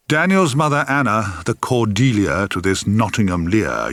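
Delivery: ordinary delivery, nothing marked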